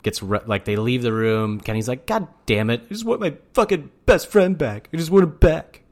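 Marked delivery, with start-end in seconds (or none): 2.1-5.8 higher, hammed-up whining plea; wailing parody of a friend begging; whiny mock plea. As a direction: overact a needy best friend begging. Mocking Kenny's emotional plea.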